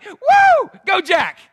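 loud, high whoop for yourself; mock cheering. Preacher boasts of depression, loud mock cheer. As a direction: cheer yourself on over bad news.

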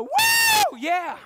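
high, loud whoop and yell; mock stadium cheer for Jesus. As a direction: cheer for Jesus like a fan in the stands. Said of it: "you go".

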